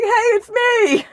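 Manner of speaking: squeaky high shout, hammed up; mock commenter. Tarot reader mimicking a viewer shouting hello. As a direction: play an eager stranger waving hi. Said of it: "be like".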